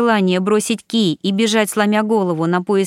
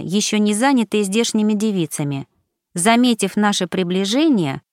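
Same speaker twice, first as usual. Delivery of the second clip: usual speaking voice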